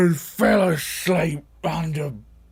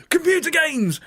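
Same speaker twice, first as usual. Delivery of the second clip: put-on excited kids; 12 semitones higher, 3 dB louder than usual.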